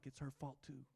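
a soft, muttered comeback; muttered retort. Retold or imitated. Husband answering his wife's advice under his breath. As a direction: mumble it under the breath, stubborn.

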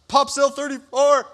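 loud, high, slow call. Retold or imitated. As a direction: call the cell number across the block. Calling out to the guard.

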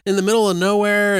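high-pitched questioning mom; nagging mother. Retold or imitated. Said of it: "and then he goes upstairs and she's like".

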